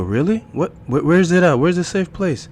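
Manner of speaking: loud, high, eager questions; urgent questioning. Acted. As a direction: burst out stammering for the answer. Man urgently asks for safe place.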